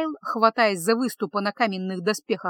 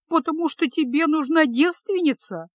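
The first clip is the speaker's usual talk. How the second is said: higher tearful question through sobs; sobbing question; 7 semitones higher than usual. A narrator's reading. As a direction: ask it choking back tears. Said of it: "всхлипнула она". (всхлипывая, выше обычного)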